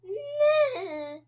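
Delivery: high whinnying horse voice; a horse calling for its supper, high whinny; horse neigh. A narrator's reading. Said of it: "says the horse".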